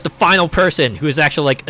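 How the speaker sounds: normal talking voice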